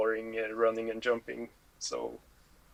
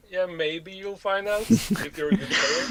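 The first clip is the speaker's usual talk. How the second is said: laughing; 8 semitones higher, 4 dB louder than usual. Real, not acted. Teasing fans, laughing.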